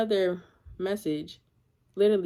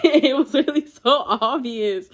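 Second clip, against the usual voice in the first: laughing; 3 semitones higher, 4 dB louder, slower than usual. Real, not acted. Laughing at how obvious he was.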